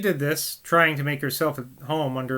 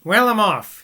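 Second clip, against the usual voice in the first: hearty rustic father; 5 semitones higher, 6 dB louder than usual. Acted. Mr Garrett repeats departure.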